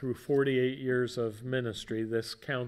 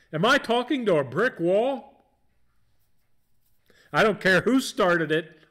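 louder scolding mom, higher; mom lecture; 8 semitones higher, 8 dB louder than usual. Retold or imitated. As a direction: scold like an exasperated mother. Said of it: "famous moms saying".

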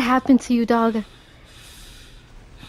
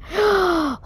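shocked gasp; 7 semitones higher, 4 dB quieter than usual. Real, not acted. Shocked by the fall.

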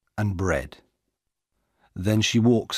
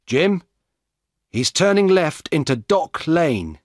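loud, higher call across the street; shout; 8 semitones higher, 4 dB louder than usual. A narrator's reading. Dan shouts directions to Jim while chasing the thief, loud. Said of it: "he shouts".

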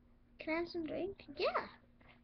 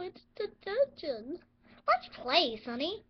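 normal talking voice

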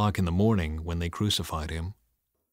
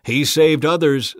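loud, higher sneer; scoffing; 5 semitones higher, 9 dB louder than usual. A narrator's reading. Leaders scoffing at Jesus, loud sneer. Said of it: "the leaders scoffed".

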